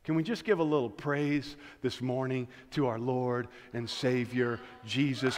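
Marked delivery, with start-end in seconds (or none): none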